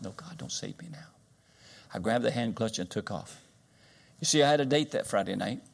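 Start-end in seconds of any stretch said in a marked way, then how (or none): 0.0-1.1 breathless whisper, no voice at all; a hushed secret refusal to God. Retold or imitated. Preacher retelling his tractor refusal to God, whispered.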